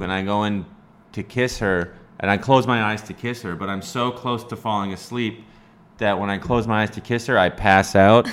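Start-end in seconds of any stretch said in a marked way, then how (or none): none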